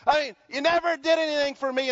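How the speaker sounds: a hint of a sulking brother; angry older son. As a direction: play the resentful older son griping at his father. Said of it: "he's mad".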